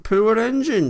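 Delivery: high, loud exclamation of pity; sympathetic engine character. A narrator's reading. Thomas reacts to accident news.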